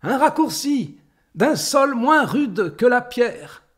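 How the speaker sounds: high, loud call; crying out. A narrator's reading. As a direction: shout it high like a tiny ant summoning others. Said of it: "crie-t-elle".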